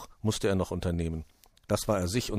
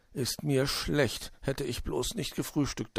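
slow, higher, softened groaning thought; queasy dread; 6 semitones higher, 4 dB quieter than usual. A narrator's reading. Willi thinks as the sled races, queasy dread. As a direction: moan it inwardly, feeling sick. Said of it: "dachte Willi und kniff die Augen".

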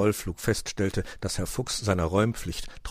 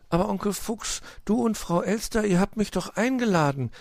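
high-voiced, astonished little nephew; much higher, taken aback; 13 semitones higher, 4 dB louder than usual. A narrator's reading. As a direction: protest in puzzled surprise. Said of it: "erwiderte sein Neffe erstaunt".

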